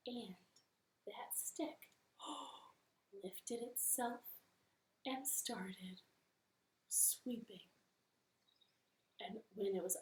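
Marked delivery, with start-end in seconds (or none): 0.1-7.7 hushed awed whisper. A narrator's reading. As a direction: whisper it in wonder. Narrator whispers wonder.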